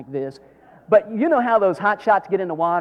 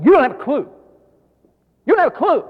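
loud and high, hammering it home; preaching shout; 10 semitones higher, 9 dB louder, faster than usual. Real, not acted. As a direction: drive it at the crowd twice. Preacher scolding the congregation.

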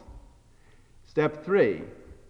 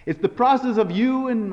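ordinary talk, nothing marked

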